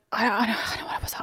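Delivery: gushing whisper. Real, not acted. Gushing about crush David.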